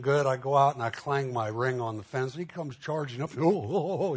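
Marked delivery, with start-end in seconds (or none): 3.4-4.1 higher-pitched goofy dog wail with laughter. Retold or imitated. Pastor imitating the neighbour's dog charging up, goofy dog. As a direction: imitate a big dog's wobbly "oh, oh".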